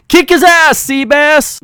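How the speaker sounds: high, loud cheering yell; mock yell. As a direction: scream it from the sidelines like a rowdy fan. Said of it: "[name]'s yelling".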